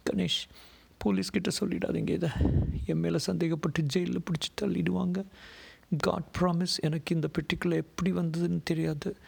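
high-pitched female voice, begging; frightened pleading woman. A narrator's reading. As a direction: implore him not to give you up. Frightened woman begs him.

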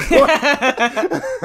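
laughing out a high What; cracking up in disbelief. Real, not acted. Laughing at a rapper's odd lyric, high and giggly.

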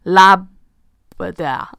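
Labrador stretched very slowly; drawn-out Labrador. Shown as a demonstration. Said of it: "his last name is spelled".

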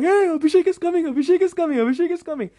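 high, loud, rapid chanting; excited kid. Retold or imitated. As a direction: announce it like a thrilled schoolboy. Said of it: "he just starts jumping in excitement".

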